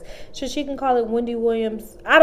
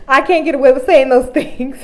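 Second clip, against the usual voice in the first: laughing through the words, louder; 4 semitones higher, 10 dB louder than usual. Real, not acted. Laughing about being jealous of the grandma, laughing while talking. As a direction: giggle out the confession.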